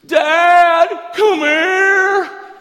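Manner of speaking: high, full-volume drawn-out yell for Dad; boy calling out. Acted. A little boy who can't sleep yells for his dad, shouted high. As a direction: holler like a kid calling down the hallway. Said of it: "All of a sudden he hears".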